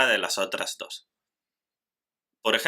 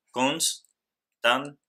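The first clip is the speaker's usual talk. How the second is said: constante broken as cons... tan... te; syllable by syllable; 4 semitones higher than usual. Shown as a demonstration. Teacher shows that NS stays together in constante, syllable by syllable. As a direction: say constante very slowly, pausing after cons and tan. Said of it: "Entonces decimos".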